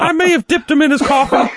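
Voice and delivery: very high squeaky little elf; Alfie voice. Acted. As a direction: squeak like a tiny elf scolding Daddy. S2 voicing Alfie.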